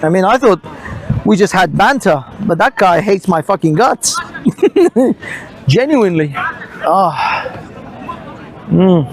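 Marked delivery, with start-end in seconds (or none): 4.2-5.4 high-pitched giggle; short, high laugh to oneself. Real, not acted. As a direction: break into a high chuckle. Laughs after saying he's hated.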